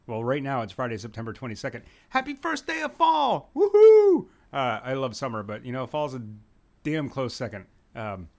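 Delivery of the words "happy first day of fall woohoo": high, loud, whooping celebration; excited cheer. Acted. Podcast host greeting listeners on the first day of fall, whooping cheer. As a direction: shout it like a party cheer for fall.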